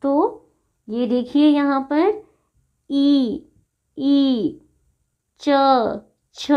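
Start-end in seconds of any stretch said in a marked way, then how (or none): 2.9-6.5 "ई, ई, च, छ" drawn out singly, tongue to the palate; palatal articulation. Shown as a demonstration. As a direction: demonstrate "ई, ई, च, छ" as isolated palatal sounds. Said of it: "तालु से उच्चारण होने वाले वर्ण".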